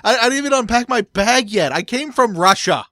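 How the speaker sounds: mock pleading player. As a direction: beg the coach for a break. Voicing Russian player arriving late.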